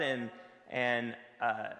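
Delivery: ordinary talk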